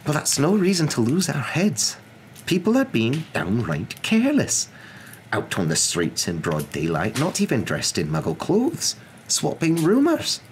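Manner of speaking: high, scolding character voice; irritable professor. Acted. Professor complains of careless people out in daylight, irritable. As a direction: scold in high, indignant swoops. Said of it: "said Professor McGonagall irritably".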